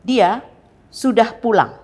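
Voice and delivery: DIA set apart, then PULANG, both loud and high; exclaimed with strong stress on DIA and PULANG; emphatic assertion. Shown as a demonstration. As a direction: hit DIA, pause, then drive PULANG down firmly. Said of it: "Ditekankan kepada dia dan kata pulang".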